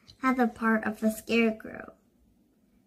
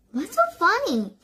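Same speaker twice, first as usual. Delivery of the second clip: high-pitched question; 9 semitones higher than usual. A narrator's reading. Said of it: "asked Bruce".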